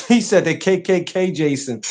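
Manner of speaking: laughing. Real, not acted. Cracking up at chat.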